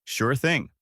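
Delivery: mildly excited agreement; excitedly. A narrator's reading. Goku agreeing to wait for the turtle, mildly excited. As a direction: agree brightly, with light eagerness. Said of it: "Goku excitedly says".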